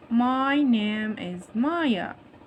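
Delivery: very slow, MY and NAME stretched out; slow modelling. Shown as a demonstration. Teacher models the dialogue for young pupils, slow and drawn-out. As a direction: draw out each word of "My name is Maya". Teacher repeats reply extra slowly.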